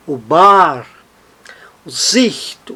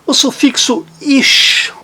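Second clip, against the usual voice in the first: ordinary delivery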